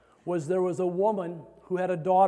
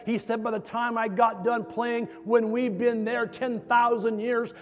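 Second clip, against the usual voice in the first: raised, driving preacher's crescendo; fervent testimony; 4 semitones higher, 4 dB louder than usual. Retold or imitated. Violinist telling students of the healing, fervent and building. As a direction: build urgently, pitch held high, punching the phrases.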